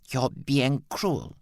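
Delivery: slow, high, wounded hiss; hissed accusation. A narrator's reading. Mother hissing at the son she mistakes for Aubrey. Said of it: "she hissed".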